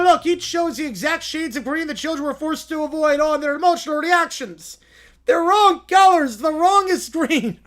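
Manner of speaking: high, loud pompous courtroom lawyer; hammy lawyer voice. Acted. To the judge, a blustering lawyer pleading his case. Acted lawyer in mock trial.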